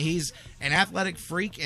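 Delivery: ordinary talk, nothing marked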